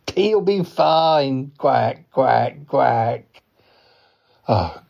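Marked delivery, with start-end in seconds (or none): 0.1-3.2 very drawn-out cartoon duck voice; slow duck drawl; easygoing duck. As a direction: stretch the words like a chuckling duck. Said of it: "he is a duck".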